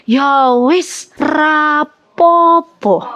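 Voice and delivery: put-on slow drawn-out old grandma voice; lightly voiced grandmother, unbothered; imitating grandma. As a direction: stretch out rap popo like a calm old Javanese grandmother. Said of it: "Beliau biasa bilang begini".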